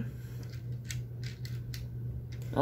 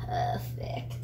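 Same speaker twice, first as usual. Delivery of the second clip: hushed villain whisper; evil whisper; 9 dB quieter than usual. Pretend. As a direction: breathe it like a villain. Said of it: "I sound like some evil person".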